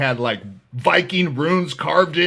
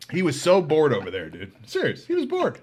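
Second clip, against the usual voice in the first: ordinary talk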